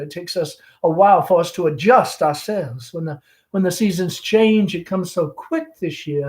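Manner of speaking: lightly voiced chatty Southern belle mother; Southern mother hostess. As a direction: gush over the guest like a fading belle. Amanda hosting the gentleman caller.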